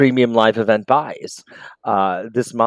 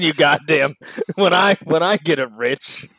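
put-on old man smoking a cigarette; 5 semitones higher than usual. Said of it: "old man smoking a cigarette vibes".

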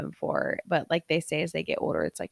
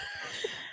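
high laugh; 11 dB quieter than usual. Real, not acted. Laughing at the assistant crying.

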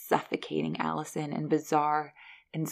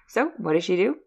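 normal talking voice

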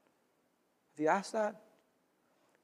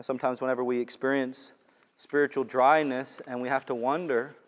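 ordinary talk

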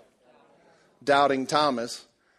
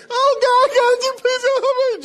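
very high, louder desperate plea; mock frantic prayer; 9 dB louder than usual. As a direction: wail to God in hammed-up panic. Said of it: "pulling all your hair out".

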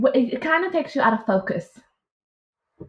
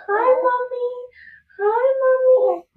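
much higher, slow and tender; tender baby voice; 13 semitones higher, slower than usual. Retold or imitated. Mother greeting her newborn, tender cooing. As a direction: coo to a newborn.

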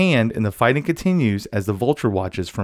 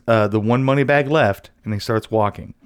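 normal talking voice

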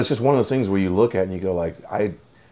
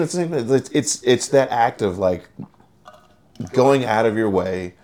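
usual speaking voice

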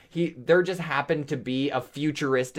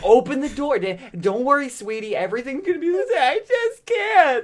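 high, sobbing plea; crying; 10 semitones higher than usual. Retold or imitated. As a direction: wail through tears. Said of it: "Just like".

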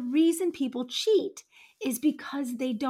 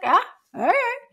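careless shrug; 6 semitones higher, 3 dB louder than usual. Retold or imitated. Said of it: "and you're like".

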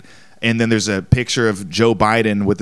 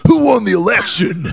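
high, loud Eastern European granny voice; hammed-up Eastern European accent; 10 semitones higher, 4 dB louder than usual. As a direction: call out as the tiny Eastern European lady. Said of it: "the small Eastern European woman".

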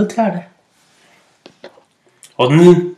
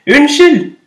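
much higher and louder call; 10 semitones higher, 5 dB louder than usual. Acted. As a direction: call out to the tree so it hears. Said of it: "ropte den lille fuglen litt høyere". (roper høyere og lysere)

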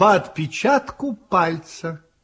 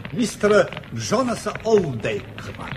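usual speaking voice

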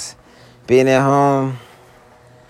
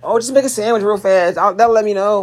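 mocking girlfriend; 8 semitones higher than usual.

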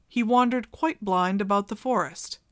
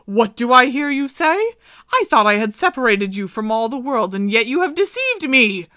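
loud, scolding witch voice; angry enchantress; 3 semitones higher, 8 dB louder than usual. Acted. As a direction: berate the deceitful girl.

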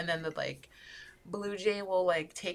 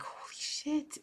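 amazed reaction; 8 semitones higher, 7 dB quieter, slower than usual. Retold or imitated. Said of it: "I was like".

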